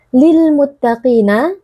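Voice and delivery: "Lil muttaqina" slowly, with the first l fully sounded; clearly sounded lam. Shown as a demonstration. Teacher shows alif lam qamariyah, "Lil muttaqina" with a clear l. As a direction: make the l in "Lil muttaqina" distinct and unhurried. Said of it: "dengan memperjelas alif lamnya".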